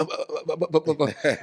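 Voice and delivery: excited stammer stuck on one sound; rapid-fire repeated "uh", never reaching the word; imitated stutter. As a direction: mimic a stutterer who can't get past "uh". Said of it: "my uncle has a stuttering problem".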